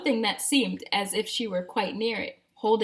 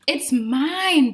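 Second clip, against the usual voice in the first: breathing soil; 5 semitones higher, 8 dB louder than usual. Acted. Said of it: "breathed the soil".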